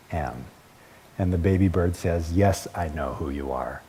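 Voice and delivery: low, hushed storybook voice; solemn story reading. A narrator's reading. Preacher reading baby bird's answer to mother, solemn and hushed. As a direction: read it softly and deliberately, letting it sink in. Reads the picture book aloud.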